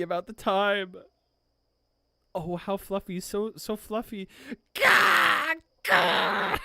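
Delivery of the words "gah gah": loud high excited squawk; squeal of delight. Real, not acted. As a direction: blurt out a strangled cry of cuteness. Overwhelmed by cute scene.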